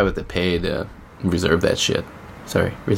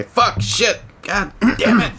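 pent-up swearing; 10 semitones higher, slower than usual. Retold or imitated. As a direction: let the swears finally explode out. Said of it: "I just wanna say".

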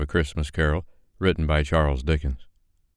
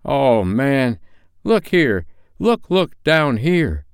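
raised, urgent ghostly call; exclaiming; 7 semitones higher, 5 dB louder, much slower than usual. Acted. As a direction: call out to make him look down. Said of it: "exclaimed the ghost".

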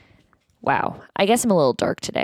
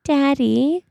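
put-on child voice; 3 semitones higher, 3 dB louder, slower than usual. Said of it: "I'm going to play the child".

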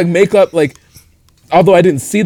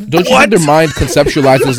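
laughing; 4 semitones higher, 5 dB louder than usual. Real, not acted. Talks through a laugh.

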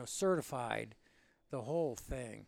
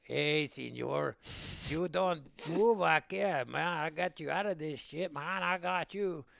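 fakey Mexican accent. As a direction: imitate a buddy hamming up a Mexican accent. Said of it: "putting on this real fakey Mexican accent".

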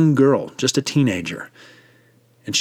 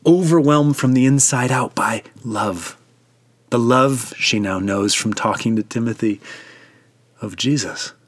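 normal talking voice

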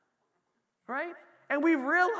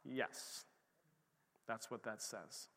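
much softer and lower, matter-of-fact; hushed reply; 10 semitones lower, 16 dB quieter, slower than usual. Real, not acted. Preacher answering the doubter, soft and low. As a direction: drop it quietly as the answer.

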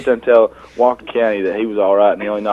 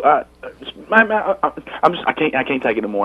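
imitating a panicked stutter, pitched up at first; 4 semitones higher than usual. Friend retelling Eric's outburst by the pool, stuttering. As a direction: trip over the words, restarting each phrase. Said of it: "you're stuttering".

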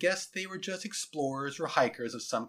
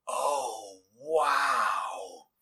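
drawn-out, open-mouthed wonder; awed amazement; 2 semitones lower than usual. Acted. Boy watching his teacher transform, awestruck. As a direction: let it out slowly, dumbstruck. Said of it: "said Jason with his mouth agape".

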